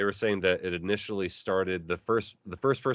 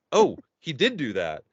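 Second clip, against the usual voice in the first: higher, louder ref voice; mock ref voice; 5 semitones higher, 4 dB louder than usual. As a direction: play the ref realizing. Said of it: "They're like".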